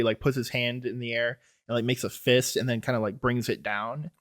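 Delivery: ordinary talk, nothing marked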